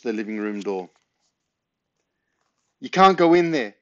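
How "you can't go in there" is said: loud, high panicked yell. A narrator's reading. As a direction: yell to stop him. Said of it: "yelled Ben".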